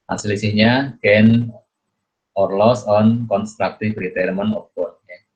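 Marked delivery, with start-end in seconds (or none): none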